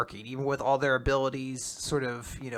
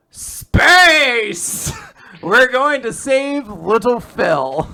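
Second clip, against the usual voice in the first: mock shout; 9 semitones higher, 11 dB louder, much slower than usual. Fuko announcing the next plan.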